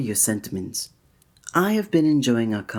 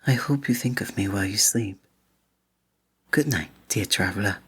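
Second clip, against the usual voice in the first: whispering; 3 semitones lower than usual. Acted. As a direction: whisper it as a tender goodnight. Goodnight to the listener.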